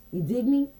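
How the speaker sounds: ordinary talk, nothing marked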